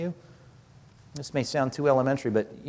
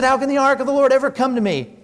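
afraid; 9 semitones higher, 4 dB louder, faster than usual. Retold or imitated. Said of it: "He's afraid of the Lord".

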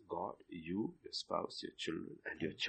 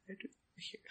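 very soft whispered question; faint, tender whisper; 10 semitones higher, 13 dB quieter, much faster than usual. Shown as a demonstration. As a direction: barely voice it, a gentle whisper.

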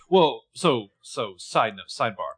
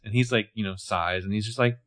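normal talking voice

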